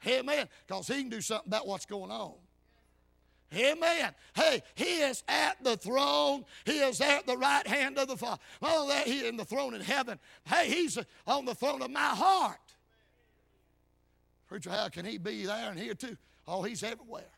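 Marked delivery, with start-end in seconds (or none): 3.5-12.8 loud, driving preacher's delivery; full-force preaching. Real, not acted. Preacher proclaiming Christ's throne to the church, full-force preaching. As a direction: preach it out, hitting key words hard.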